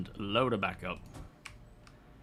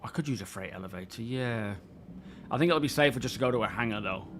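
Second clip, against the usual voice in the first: normal talking voice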